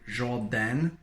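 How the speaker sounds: "Jordan" said with a French zh and a nasal final syllable; French-accented name. Shown as a demonstration. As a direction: say "Jordan" like a French speaker would. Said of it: "I changed my own name from Jordan to".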